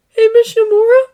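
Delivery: putting on a brave face; young Izuku. A narrator's reading. Izuku reassuring All Might as he leaves. Boy calling.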